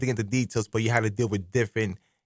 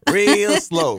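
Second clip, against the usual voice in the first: mock slow talk, a little raised; drawn-out imitation; 7 semitones higher, 4 dB louder than usual. Host teasing guest about how her people talk, drawn-out imitation. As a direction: stretch it out, imitating slow talkers. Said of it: "how y'all talk and stuff like that".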